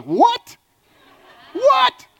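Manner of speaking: second "What?" leaps very high and loud; delighted surprise. Retold or imitated. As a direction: yelp it, thrilled and amazed. Delighted someone guessed his hometown.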